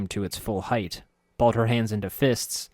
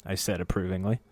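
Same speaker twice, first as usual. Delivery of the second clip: ordinary delivery, nothing marked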